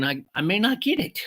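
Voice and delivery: ordinary delivery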